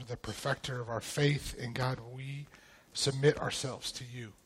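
quiet, reverent prayer to God; soft opening prayer. Acted. Pastor opening the class by praying softly to the Lord. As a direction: pray softly over the group, unhurried.

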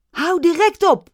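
high, loud outraged shout. Acted. Young woman orders the actors to stop, shouting. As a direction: demand it, full voice.

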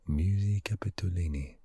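smoother second go at the Italian name; Italian accent attempt. Shown as a demonstration. Retrying the museum name in a light Italian accent. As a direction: say it like an Italian would. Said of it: "My Italian accent isn't that good".

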